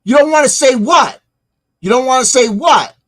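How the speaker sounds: loud, high, incredulous demand; indignant challenge. Real, not acted. Commentator challenging the man in the video, indignant shout.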